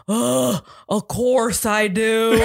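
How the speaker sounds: drawn-out, grunted mock reply; imitated grumpy grunt; imitating a grunt. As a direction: grunt it out like an exasperated little kid, then laugh. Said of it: "he, like, grunted at me".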